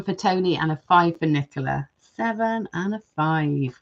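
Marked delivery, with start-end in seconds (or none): 2.0-3.8 slow, softer, spelled-out repeat of the numbers; slow restating of the scores for the chat. Real, not acted. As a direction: lay each number down slowly as she notes it. Repeating votes slowly.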